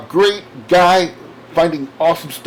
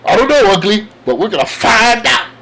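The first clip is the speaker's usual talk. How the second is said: loud, higher-pitched superhero boast; comic-book Spidey; 4 semitones higher, 6 dB louder than usual. Acted. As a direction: punch it out like a cartoon hero. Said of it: "And then Spidey goes".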